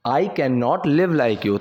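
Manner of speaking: very slow, word by word for learners; slow model reading. Shown as a demonstration. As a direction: spell it out slowly like a teacher. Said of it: "teacher models English sentence". (बहुत धीमे, शब्द-दर-शब्द पढ़ाते हुए)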